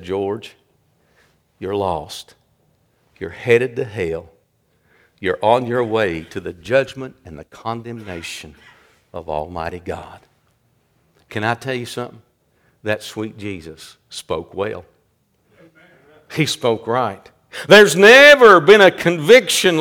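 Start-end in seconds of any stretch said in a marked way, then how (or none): 0.0-10.2 low, soft, grave pronouncement; hushed conviction. Retold or imitated. Preacher voicing Jesus warning him he's lost, hushed conviction. 11.3-17.3 low, soft, weighty sermon delivery; hushed preaching. Real, not acted. Preacher marvelling at Jesus before the congregation, hushed preaching.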